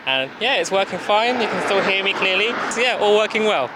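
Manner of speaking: calling from across the road; from across the street. Real, not acted. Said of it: "So I am across the road now".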